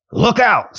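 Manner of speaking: a sudden surly warning. A narrator's reading. Dwarf warns approaching visitors, surly. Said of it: "in a surly voice".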